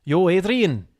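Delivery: put-on botched accent that lands in Scottish; Scottish-sounding accent. Trying a film quote, accidental Scottish accent. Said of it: "He's definitely Scottish".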